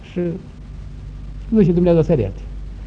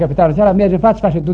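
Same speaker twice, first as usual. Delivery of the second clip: normal talking voice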